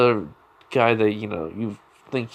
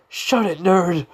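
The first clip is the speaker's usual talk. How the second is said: high, louder acted snap; irritated retort; 8 semitones higher, 3 dB louder, slower than usual. Bakugo shutting up his rival, irritated retort. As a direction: cut the nerd off angrily.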